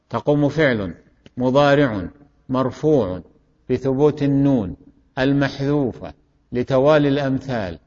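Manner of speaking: term by term, with pauses; deliberate repetition. Shown as a demonstration. Teacher repeating the parsing one last time, measured and segmented. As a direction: recite it in short separate chunks for learners. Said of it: "أعيده آخر مرة".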